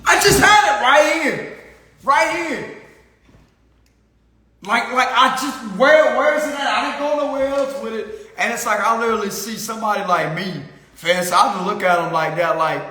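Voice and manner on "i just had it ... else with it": hammed-up frantic shout up high; mock panic. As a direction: overplay the frantic lost-wallet search. Imitating panicked wallet loser.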